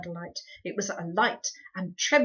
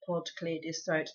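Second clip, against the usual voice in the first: usual speaking voice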